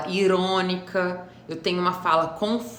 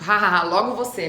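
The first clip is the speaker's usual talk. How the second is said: loud fake laugh into a jab; mock them with a put-on ha-ha-ha; irônica; 5 dB louder than usual. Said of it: "eu sou irônica". (ironia com risada forçada)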